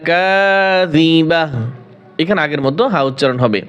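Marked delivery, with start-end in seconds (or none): 0.1-1.4 slow, drawn-out tajweed chant; Quran recitation. Shown as a demonstration. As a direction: recite each word with long held vowels. Recites the verse. (ধীরে টেনে টেনে কোরআন তিলাওয়াত)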